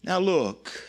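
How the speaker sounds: ordinary talk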